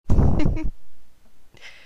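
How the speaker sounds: loud breathy evil laugh. Acted. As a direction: cackle like a cartoon villain. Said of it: "uma risada maligna". (risada maligna)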